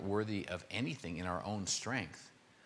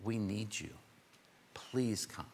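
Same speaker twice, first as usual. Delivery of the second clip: hushed plea sinking into a whisper; humble plea; 4 dB quieter, slower than usual. Retold or imitated. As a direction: beg humbly, breathing out "Please come". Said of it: "to humble themselves and say".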